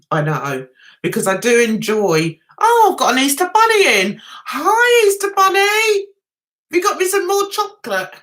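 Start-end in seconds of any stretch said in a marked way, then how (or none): 2.6-8.1 high, gushing vlogger imitation; mock gushing. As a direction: mimic his overexcited greeting at a raised pitch.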